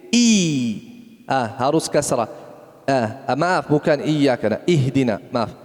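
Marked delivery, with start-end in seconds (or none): none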